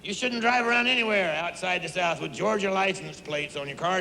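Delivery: ordinary talk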